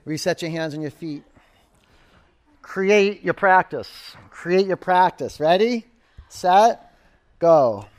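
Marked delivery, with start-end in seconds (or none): 2.6-7.9 loud, drawn-out coach's call; rally the class to go; projected, emphatic start call. Real, not acted.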